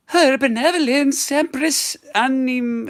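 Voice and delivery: very high, drawn-out herald; royal herald voice. Acted. Creature announcing the Empress, high ceremonial voice.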